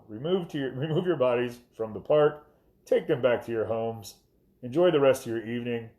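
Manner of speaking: loud, overly polite police loudspeaker; mock courteous loudspeaker order; LRAD announcement voice. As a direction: announce it like a courteous riot-control speaker. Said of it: "That's basically exactly what it sounded like".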